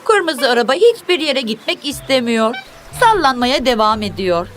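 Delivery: ordinary delivery, nothing marked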